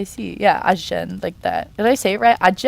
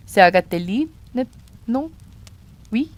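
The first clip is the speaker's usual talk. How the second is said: light French accent on C'est Agatelli; mild French accent with rising questions; 3 semitones higher than usual. Shown as a demonstration. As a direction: play at being French, lightly. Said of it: "let me put my French accent on".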